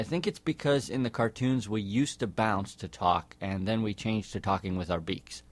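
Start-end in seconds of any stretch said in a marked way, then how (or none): none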